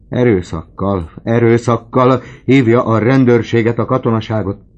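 loud, high, demanding cries; desperate old man shouting; frantic shout. Acted. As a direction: yell it like a dying man's last order. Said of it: "kiáltott az öreg".

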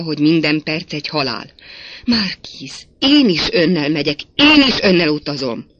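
loud, urgent lovers' quarrel; impassioned pleading. A narrator's reading. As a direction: plead loudly, hitting each phrase hard. Heated quarrel between lovers. (hangos, szenvedélyes könyörgés)

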